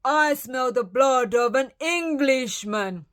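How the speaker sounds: big, drawn-out storybook giant chant; giant's shout. A narrator's reading. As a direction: start loud and high, easing off. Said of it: "he shouted".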